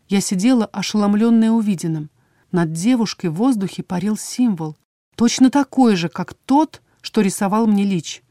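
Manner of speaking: plain reading voice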